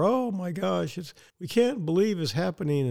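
high, mock-shocked gasp of disbelief. Mocking media's disbelief.

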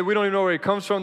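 loud and fast, mocking their words; loud scoffing Pharisees. Preacher quoting the Pharisees, loud and scoffing. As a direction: throw their dismissal back loudly. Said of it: "that's what they say".

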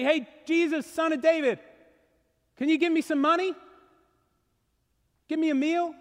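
high-pitched, casual cadging; mock begging. Acts out a plea he didn't make.

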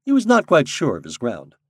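ordinary delivery, nothing marked

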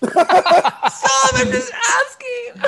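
high squeal through laughter; laughing too hard to speak. Real, not acted. Laughing at teasing.

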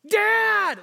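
put-on loud, high child's yell for dad; terrified yell. Frightened child yells for sleeping dad, terrified yell. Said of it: "they let out this loud yell".

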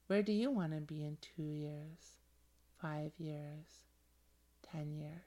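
hushed, dreamy visualization guide; guided visualization. Acted. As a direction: lead them gently into their dream future.

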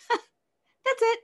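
high, loud, laugh-through "that's it". Real, not acted. Telling the interviewer how it ended, laughing in disbelief. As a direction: giggle out the words in disbelief.

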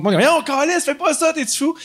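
high alarmed pleading; shocked fans. Retold or imitated. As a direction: cry out in disbelief. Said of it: "tout le monde".

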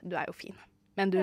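very soft, low, offhand murmur; understated reassurance. Retold or imitated. Soundman dad reassuring his shocked child, hushed and understated. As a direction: play down the danger, imitating an unbothered dad.

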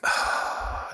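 long voiceless sigh of defeat; exasperated sigh. Retold or imitated. Dad reacting to his insistent daughter, exasperated sigh. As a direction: let the breath out helplessly.

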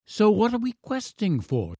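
put-on high, eager child; demanding boy. A narrator's reading. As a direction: demand it as an excited little boy. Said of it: "demanded the boy".

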